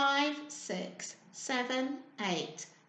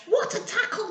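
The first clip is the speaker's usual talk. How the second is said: higher, cheering spiders; excited cry; 9 semitones higher, 4 dB louder than usual. A narrator's reading. Spiders watching football, excited higher cheer. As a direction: cheer the tackle. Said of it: "they cried".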